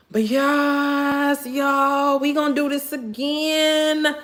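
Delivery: high, drawn-out happy sing-song; sing-song promise. Real, not acted. Happy promise of another live.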